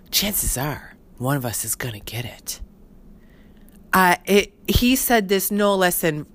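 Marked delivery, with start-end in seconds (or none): 0.1-2.6 low side-of-the-mouth whisper, dead serious; mock stage whisper of grim news. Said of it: "he'll get into a whisper".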